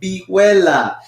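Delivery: Vihuela as "bee-WEH-la"; Spanish pronunciation. Shown as a demonstration. Teacher invites guys to repeat, Spanish pronunciation. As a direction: model "Vihuela" clearly in Spanish, middle syllable stressed. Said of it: "Try to say that".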